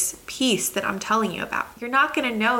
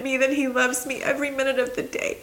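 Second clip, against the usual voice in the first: ordinary delivery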